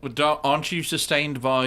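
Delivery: ordinary talk, nothing marked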